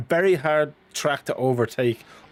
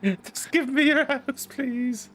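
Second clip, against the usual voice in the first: mock greedy beggar; 12 semitones higher, much slower than usual. Teasing co-host, begging for his house in a squeaky voice. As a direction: whine for the house like a cartoon miser.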